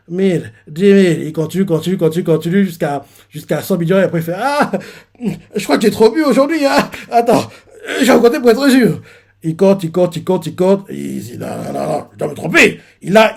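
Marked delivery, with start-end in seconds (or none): 4.4-9.0 put-on high, whiny half-crying drunk; fretting that the drink is lying; mock tearful fluster; tipsy alarm. Drunk man recounting, alarmed.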